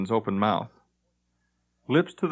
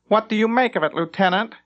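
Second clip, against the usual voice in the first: haughty captain; 10 semitones higher, 3 dB louder than usual. Acted. Captain questions lieutenant.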